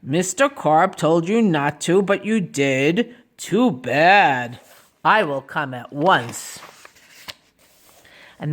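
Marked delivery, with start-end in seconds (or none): 0.0-6.6 low, slow officer's voice; policeman. Acted. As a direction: answer as a deliberate, deep-voiced policeman. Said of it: "said the policeman".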